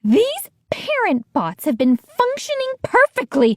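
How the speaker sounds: high, furious scolding; angry villain. A narrator's reading. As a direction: scold them like a mad scientist.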